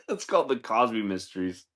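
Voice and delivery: normal talking voice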